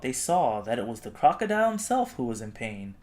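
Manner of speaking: usual speaking voice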